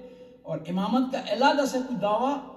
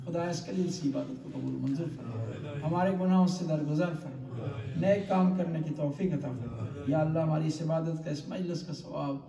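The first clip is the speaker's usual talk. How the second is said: closing prayer; 6 semitones lower than usual. Acted. Closing prayer after the sermon. (دھیمی آواز میں دعا)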